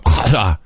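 "Qatar" from deep in the throat; mock-throaty first sound in "Qatar"; exaggerated guttural take on "Qatar". Shown as a demonstration. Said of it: "kind of a guttural way".

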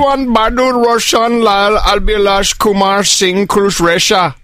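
put-on a mild Indian accent on the full name; lightly voiced Indian accent. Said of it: "use our accents".

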